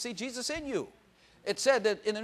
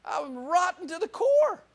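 raised, slow, hammed-up self-mockery; mock confession; 12 semitones higher, 6 dB louder, slower than usual. Preacher teasing his wife Peg about himself, mock confession. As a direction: play up how rotten you are, grinning. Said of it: "I always go like".

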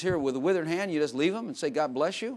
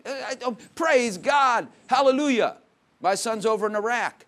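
higher, joyful burst of thanks; excited praise; 5 semitones higher, 4 dB louder than usual. Retold or imitated. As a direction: act out a parent's delighted relief. Parent retells answered prayer, rejoicing.

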